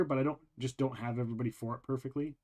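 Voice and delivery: usual speaking voice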